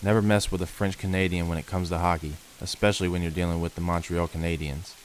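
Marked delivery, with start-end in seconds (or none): none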